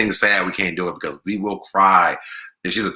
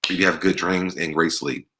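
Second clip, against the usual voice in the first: normal talking voice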